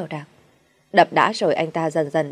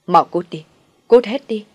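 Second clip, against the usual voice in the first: louder, higher furious yell; roaring; 4 semitones higher, 7 dB louder than usual. Acted. Husband roaring at bride to get out. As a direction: drive them out with a roar. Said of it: "Anh ta gầm lên".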